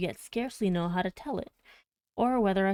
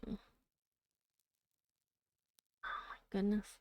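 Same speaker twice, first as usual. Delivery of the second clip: exasperated aside; 10 dB quieter than usual. Real, not acted. Reader overwhelmed by the dialect.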